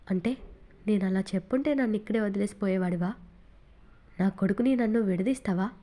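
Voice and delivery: ordinary delivery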